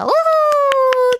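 long high whoop; excited whoop. Real, not acted. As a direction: cheer for the winner. Cheer for first winner.

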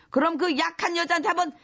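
ordinary delivery

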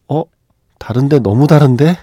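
rising shock at the twist; building disbelief. Retold or imitated. Audience voiced, growing shock.

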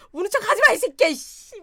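loud, high furious cursing; angry yell. Retold or imitated. Wife yells at fake-crying husband, furious. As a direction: curse him out.

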